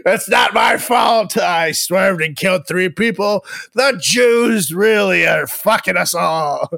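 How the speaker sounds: hammed-up indignant shouting; loud, high-pitched blame-everyone tirade; mock drunken rant. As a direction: shout excuses like a ranting drunk. Acting out Mel Gibson's drunk rant.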